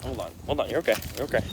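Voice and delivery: soft, real reassurance to a pet; soothing. Real, not acted. Owner calming his dog, soothing. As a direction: gently settle the dog, quietly.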